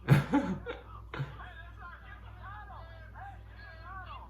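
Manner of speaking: high shrieking laugh; shocked laughter. Real, not acted. Reacting to funny animal video.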